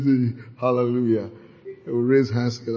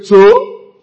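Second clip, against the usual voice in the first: rude retort; 6 semitones higher, 11 dB louder than usual. Pretend. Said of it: "They don't say".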